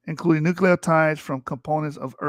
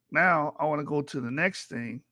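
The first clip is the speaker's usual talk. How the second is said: usual speaking voice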